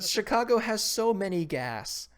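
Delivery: high, slow goofy voice; dopey cartoon voice, sing-song; silly goofy voice. Mock silly game voice.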